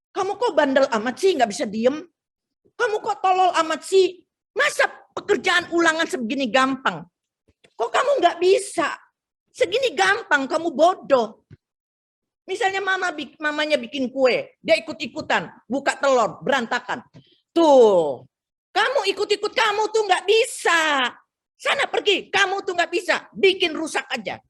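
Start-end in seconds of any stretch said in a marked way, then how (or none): 0.1-11.5 scolding parent. Retold or imitated. 17.5-24.4 high, sharp mock scolding; mother scolding a child who broke eggs, irritated.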